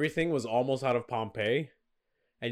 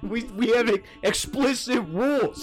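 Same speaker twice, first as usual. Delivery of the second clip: mock angry Roman; 8 semitones higher than usual. Romans complain about the sacrifice, mock Italian rant. Roman voiced outrage continues.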